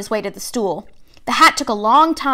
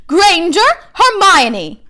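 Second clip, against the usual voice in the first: calling out a name; 7 semitones higher, 5 dB louder, much slower than usual. A narrator's reading. Name called out at sorting.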